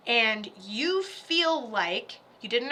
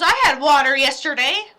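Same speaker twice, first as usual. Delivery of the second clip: lightly mocking dad, louder; imitating dad; 2 semitones higher, 7 dB louder than usual. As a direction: quote Dad with loud, mild defensiveness. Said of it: "He goes".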